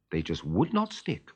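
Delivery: ordinary delivery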